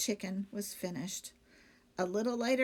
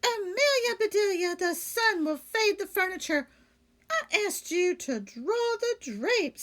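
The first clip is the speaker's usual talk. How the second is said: higher, louder dismayed lady; Missus Rogers; 10 semitones higher, 5 dB louder than usual. Acted. Lady of the house scolding her maid, high and dismayed.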